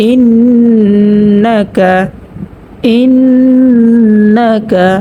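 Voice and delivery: ইন্না with a long nasal ন্ন, very slow; ghunnah recitation. Shown as a demonstration. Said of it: "গুন্না করিয়া পড়তে হবে". (ইন্না-তে ন্ন নাকে টেনে)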